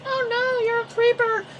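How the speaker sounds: very high, squeaky schoolgirl voice; scared schoolgirl. Retold or imitated. Schoolgirl recoiling from stranger on train, high squeal. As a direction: shriek it like a frightened little girl.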